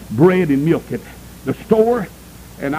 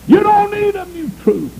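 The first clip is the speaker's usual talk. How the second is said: much higher and louder, DON'T hammered; rising preacher shout; 4 dB louder than usual. Shown as a demonstration. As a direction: lift it up and hit don't hard. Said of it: "preacher building the repeated line".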